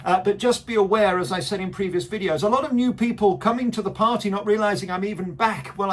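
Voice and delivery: ordinary talk, nothing marked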